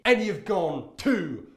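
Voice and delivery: slow, loud, hammed-up scolding; mock outrage. Vlogger scolds Andrew Tate in hammed-up slow outrage. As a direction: drag it out like a mock telling-off.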